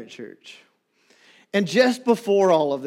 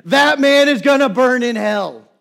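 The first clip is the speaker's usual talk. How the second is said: higher, louder mock yell of an angry woman; retold yelling; 7 semitones higher, 8 dB louder than usual. Pastor imitating the woman who yelled at him, mock yell. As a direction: hurl the curse down the hall. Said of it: "who was yelling throughout the hallway".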